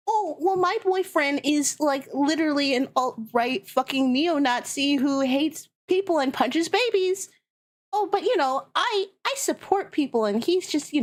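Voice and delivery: hammed-up imitation of a woman defending her boyfriend; mock liberal woman. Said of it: "they're like".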